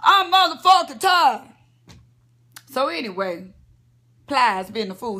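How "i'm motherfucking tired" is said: put-on the girl's line again, higher and louder; the girl answering her mama, loud and defiant; louder repeat. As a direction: belt it out defiantly. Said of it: "And she said".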